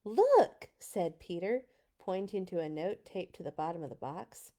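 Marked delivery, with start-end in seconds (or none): 0.1-0.7 high, loud eager shout; excited call. A narrator's reading. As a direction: call out, thrilled to find it. Peter calls out excitedly.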